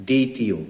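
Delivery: দ্বিতীয় said as ditiyo, no b sound; pronunciation demo. Shown as a demonstration. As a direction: drop the b-phala in দ্বিতীয়. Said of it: "এটা উচ্চারণ করতে হয় কি?". (দ্বিতীয় উচ্চারণ দিতিয়ো, ব বাদ)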